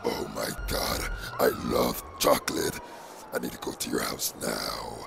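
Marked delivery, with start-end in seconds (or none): none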